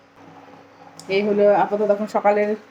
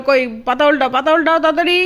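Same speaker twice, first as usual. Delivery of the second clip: higher, louder, hurrying her; 5 semitones higher, 7 dB louder than usual. Real, not acted. Urging toddler to turn page.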